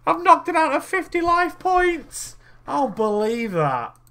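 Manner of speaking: high, loud and slow, can't believe it; a real cry of disbelief at the screen; stunned disbelief. Real, not acted. Player shouting at his game about fifty life points, high stunned disbelief. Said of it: "reacting to the game".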